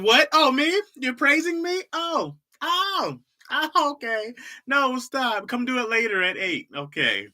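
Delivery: high-pitched coy fake modesty; hammed-up bashful squeal; mock-flattered. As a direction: gush like you secretly love the praise. Acts out being praised, coy.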